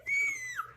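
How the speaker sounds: high giddy giggle; a burst of thrilled squeaky laughter; excited giggle. Real, not acted. Giddy over her package.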